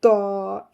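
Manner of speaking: तौ with only a hint of u; restrained round t. Shown as a demonstration. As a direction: keep the rounding on तौ small. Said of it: "इतना उसको limited रखना है".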